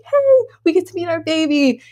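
high, bright and upbeat; bright cheery. Retold or imitated. To her scared husband, a high cheery hey. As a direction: chirp it up to keep spirits high. Keeping spirits up for Alex.

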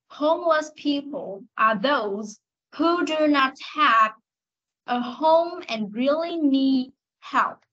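slow, careful English model reading. Shown as a demonstration. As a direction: read it slowly for the class to copy. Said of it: "Nghe này". (đọc mẫu chậm rãi)

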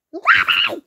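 high, loud indignant shout. Acted. Child correcting a friend, shouting the name Lappy. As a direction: yell the name to set them straight.

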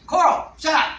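high shouted parrot imitation; parrot yelling. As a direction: squawk it out like a bossy parrot. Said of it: "he'll go".

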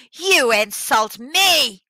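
loud, slow, indignant tyrant; screamed outrage. A narrator's reading. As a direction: draw out each word in loud outrage. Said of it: "the tyrant screamed".

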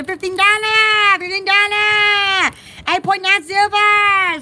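loud, drawn-out seller's call; hawking. Pretend. Mock seller announcing fifteen dollars, calling out. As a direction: call the price out like a vendor. Hawking goods, all for sale.

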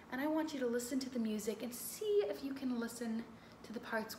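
soft, gentle guiding voice; soothing listening instructions; calm guide. Acted. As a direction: settle the kids down to listen.

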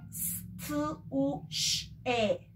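"s, t, u, sh" segmented; letter sounds apart; sounded out again. Shown as a demonstration. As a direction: repeat sounds one by one. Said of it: "repíteme".

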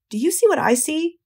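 high, amazed question; hushed wonder rising high; awed question. Acted. Lurvy awed at web.

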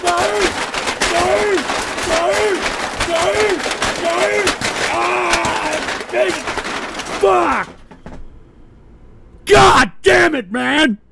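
explode, screaming "No!" over and over; furious shout. Real, not acted. Raging after a donation clip.

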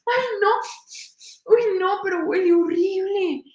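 put-on high, whining old man lamenting; despairing grandpa. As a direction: moan like a grandpa who can't escape the smell. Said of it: "y decía". (abuelito lamentándose, agudo)

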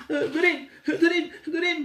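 high loud call; calling the magic word. Acted. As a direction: chant the spell word eagerly. (hoog roepen van het toverwoord)

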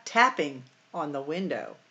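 ordinary talk, nothing marked

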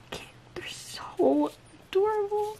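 high, soft, breathy-free whisper of delight; overwhelmed cooing whisper; adoring whisper. Real, not acted. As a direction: whisper it like it's too cute to bear. Gushing over stuffed animal stickers.

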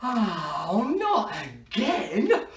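slow, stretched-out groan of dismay; mock dismay. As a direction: play up the dismay with a laugh in it. Wand breaks in front of children.

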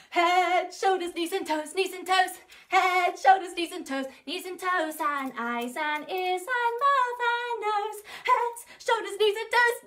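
high, quick excited singing. Shown as a demonstration. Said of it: "even faster".

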